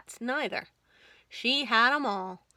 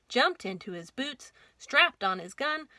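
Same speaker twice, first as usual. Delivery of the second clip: ordinary delivery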